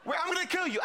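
mock threat pitched high; angry king. King threatening the three prophets, mock anger. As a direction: act out a king's threat, lightly and high. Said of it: "the king gets angry".

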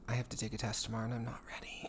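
fast secret whisper. Real, not acted. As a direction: hush it like a secret. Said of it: "Shh, don't tell my parents".

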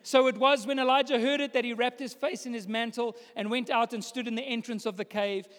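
ordinary talk

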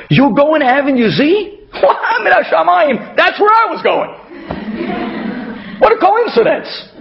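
very high, laughing overjoyed exclamation; hammed-up joyful astonishment; delighted disbelief. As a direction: act thrilled by an amazing coincidence. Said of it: "that turn to the guy and say".

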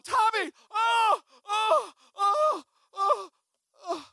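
high, loud wails in a row; panicked screaming. Retold or imitated. Mocking his own fear of toothless dog, high repeated screams. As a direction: scream a name, then repeated terrified cries.

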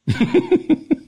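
a sudden high laugh at her own punchline; high burst of laughter. Real, not acted. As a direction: break into a high, delighted laugh.

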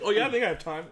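high and loud, laughing; breezy nurse agreeing to murder; mock cheerful nurse.

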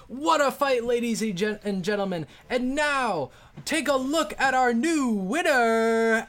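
booming high arena announcer. Acted. GM voicing the announcer to the arena crowd, booming. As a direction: hype up the crowd like a ringside MC. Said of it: "the announcer says".

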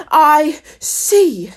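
high, imperious voice; ominous queen. A narrator's reading. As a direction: pronounce it loudly as an angry queen. Queen examines the gardeners' work.

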